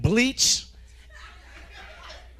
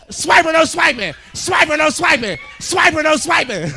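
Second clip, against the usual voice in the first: loud, higher chant at the screen; stoned man yelling at cartoon; 5 semitones higher, 6 dB louder than usual. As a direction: shout it at the TV like a kid. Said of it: "You know, into it".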